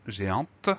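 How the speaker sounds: géante ending on a sounded T; audible final T. Shown as a demonstration. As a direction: release the T at the end of géante. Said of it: "lettre muette qu'on va entendre".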